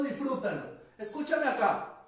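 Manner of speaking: usual speaking voice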